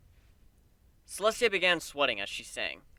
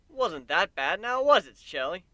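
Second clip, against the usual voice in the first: teasing; 5 semitones higher, 6 dB louder than usual. Acted. Teasing Celestia after song.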